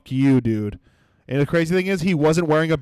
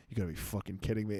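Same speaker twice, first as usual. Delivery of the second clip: fast, much softer muttered aside; 7 semitones lower, 15 dB quieter, much faster than usual. Retold or imitated. Muttering to his girlfriend in disbelief, fast and low. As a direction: mutter it under your breath to your girlfriend. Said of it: "I go".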